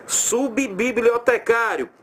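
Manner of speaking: Subbibliotecário said slowly, both B's clear; slow demo word. Shown as a demonstration. Teacher sounding out Subbibliotecário for the class. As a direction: stretch out Subbibliotecário. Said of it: "O sub aqui termina com B".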